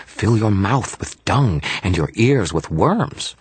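put-on high, grandiose little-kid menace; Toot's defiant threat. A narrator's reading. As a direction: pronounce a dire curse in a high fairy voice. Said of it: "crossed his arms defiantly".

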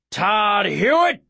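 slow, high roared name; roared like a lion. Acted. Hunter roaring the boy's name, slow and loud. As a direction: roar it out like a lion. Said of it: "Roared loud as a lion".

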